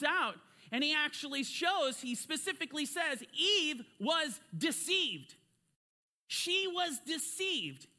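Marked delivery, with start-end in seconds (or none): none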